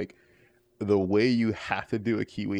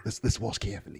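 Crocodile Hunter Australian; 4 dB quieter, faster than usual. Acted. Watching his brother like wildlife, quieter Australian nature-show host. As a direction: confide it like an Aussie wildlife presenter. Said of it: "kind of Crocodile Hunter style".

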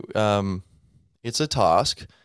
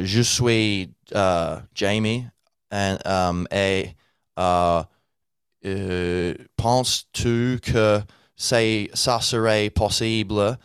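slow, halting French in an English accent; 4 dB louder, much slower than usual. Shown as a demonstration. Learner introducing himself to French people, halting English-accented French. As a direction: plod through French with English sounds.

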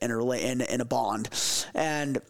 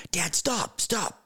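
imitated little boy's urgent call, higher pitch; urgent child; 4 semitones higher, slower than usual. Son stops dad walking down the trail, urgent. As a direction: lightly voice an excited kid halting dad.

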